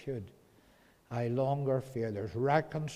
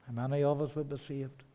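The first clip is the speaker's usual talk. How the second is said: ordinary talk, nothing marked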